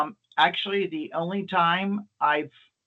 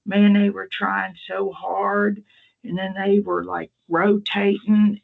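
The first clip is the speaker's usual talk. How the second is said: put-on higher, louder, slowed old-man telling; her elderly dad; 5 semitones higher, 4 dB louder, slower than usual. Retelling her dad's words at the funeral, higher and slow. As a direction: imitate the dad earnestly describing the firemen. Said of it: "and, and her dad goes".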